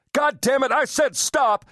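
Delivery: very high, furious yelling; enraged, high-pitched outburst; screamed curse. A narrator's reading. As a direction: scream in rage at a struggling victim. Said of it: "he screamed".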